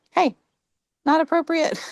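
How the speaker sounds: high, pointed hey, not appropriate; firm callout. Retold or imitated. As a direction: call it out firmly. Nudging teacher about bias.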